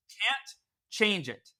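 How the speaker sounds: slow and deliberate, each word weighed; three powerful words. Shown as a demonstration. Telling listeners the words to say when the timer goes off, slow and firm. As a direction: land Can't change it like a firm decision. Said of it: "you say three powerful words".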